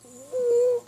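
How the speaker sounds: high, drawn-out ooh; awed, very high-pitched coo; marveling. Acted. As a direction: ooh in squeaky wonder. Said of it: "Pinkie Pie marveled".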